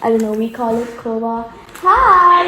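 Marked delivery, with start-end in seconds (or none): 1.8-2.5 high, loud surprised hi; excited greeting. Real, not acted. Vlogger greeting someone who walks in, excited call. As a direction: call out a big bright hello.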